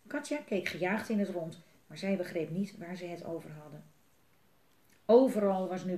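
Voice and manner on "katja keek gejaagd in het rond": usual speaking voice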